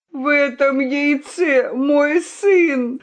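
slow, high anguished confession; tearful groan. A narrator's reading. The weeping rooster confesses the egg is his son, groaning. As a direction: groan it out, drawn and stricken. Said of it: "застонал петух".

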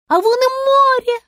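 high, loud yell of a star; excited yelling. A narrator's reading. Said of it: "орала звезда". (громкий восторженный крик)

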